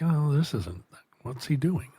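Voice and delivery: softly imitated murmur of complaint; friends' uneasy grumbling. Friends quietly grumbling about the groundskeeper spraying, muttered. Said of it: "there's a little bit of".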